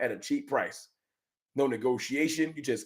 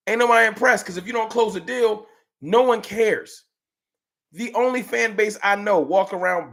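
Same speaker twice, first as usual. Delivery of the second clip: heated rant; 6 semitones higher, 3 dB louder than usual. Real, not acted. Rant builds.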